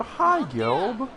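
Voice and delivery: high, loud call-out to a viewer; imitated friendly greeting. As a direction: greet someone by name, voice jumping up. Calling out a viewer by name.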